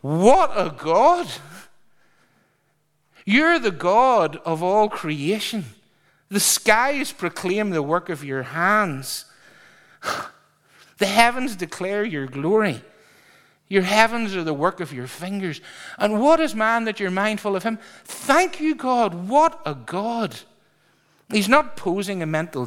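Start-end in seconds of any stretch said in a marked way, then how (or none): 0.0-17.8 breathless praise to God. Shown as a demonstration. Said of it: "He is really saying". 18.0-20.4 breathless praise. Acted.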